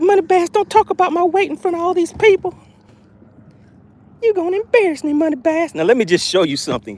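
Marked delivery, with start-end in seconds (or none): 0.0-5.7 high put-on voice of an embarrassed fish; voicing the fish. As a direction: squeak it out like a fish shamed in public. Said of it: "She's like".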